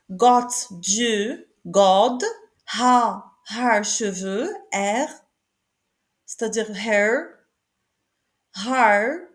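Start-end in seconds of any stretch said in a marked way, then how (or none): none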